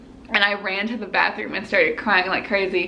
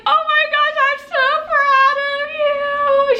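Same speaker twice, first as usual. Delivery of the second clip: high, drawn-out tearful squeal; happy crying; 4 dB louder than usual. Retold or imitated. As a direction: imitate a friend crying with pride. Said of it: "She starts crying".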